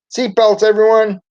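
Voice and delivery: high, loud call to the class; calling back. A narrator's reading. Teacher calling back to the kids to buckle up. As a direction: call it back over your shoulder. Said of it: "Miss Frizzle called back to us".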